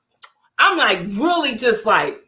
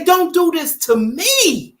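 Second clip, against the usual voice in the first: pleading husband; 8 semitones higher, slower than usual. Retold or imitated. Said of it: "He say".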